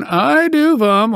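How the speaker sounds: a touch of a cranky deacon swearing; mock oath. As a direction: swear it like a stubborn old deacon. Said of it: "the deacon swore".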